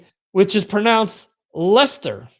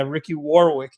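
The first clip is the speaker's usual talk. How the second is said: usual speaking voice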